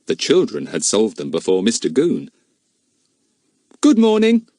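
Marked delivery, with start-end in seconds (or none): 3.8-4.5 put-on high, polite boy voice; a polite young boy greeting. A narrator's reading. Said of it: "said Larry politely".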